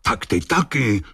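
enraged roar. A narrator's reading. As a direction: turn on him in restrained fury. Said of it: "zařval kapitán celý vztekem bez sebe". (vzteklý kapitán)

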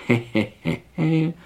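measured, spoken-out he-he laugh; acted chuckle. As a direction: chuckle in character as Rumcajs. Rumcajs chuckles in his reply. (hrané pochechtávání)

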